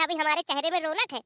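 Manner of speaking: ordinary talk, nothing marked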